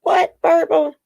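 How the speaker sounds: surprised. Real, not acted. Reacting to a viewer's comment.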